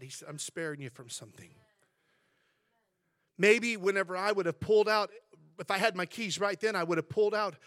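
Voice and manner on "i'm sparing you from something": just like that. Retold or imitated. Pastor showing the church how God said it, low hush. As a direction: lower and softer, a hushed voice of God. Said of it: "he, just like that".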